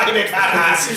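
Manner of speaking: shouted in a put-on Irish leprechaun voice; mock leprechaun shout. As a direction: bark it like an angry cartoon leprechaun.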